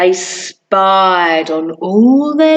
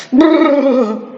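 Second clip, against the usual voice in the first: shivering brrr. Acted. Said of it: "the frozen postman".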